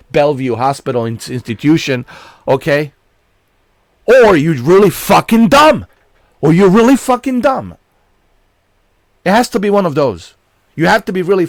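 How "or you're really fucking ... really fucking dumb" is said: angry shout. Real, not acted. Ranting about Sony's logic.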